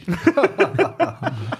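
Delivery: high, loud burst of laughter. Real, not acted. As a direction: crack up laughing. Guest cracks up.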